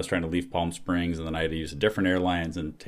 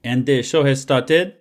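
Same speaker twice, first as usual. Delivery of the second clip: put-on French accent, raised and slowed; slow, loud, higher-pitched French accent; 7 semitones higher, 6 dB louder than usual. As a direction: announce it loudly in a mock French accent. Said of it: "French accent".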